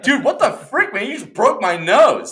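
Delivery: high, loud fake outrage; mock victim. Blaming a stranger for a punch, hammed-up outrage. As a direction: pretend someone hit you. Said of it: "And I'm like".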